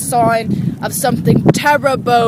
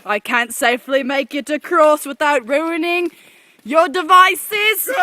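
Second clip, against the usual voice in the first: normal talking voice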